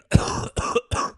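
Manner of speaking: loud forced fake cough. Shown as a demonstration. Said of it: "that was me fake coughing".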